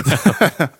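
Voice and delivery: ordinary talk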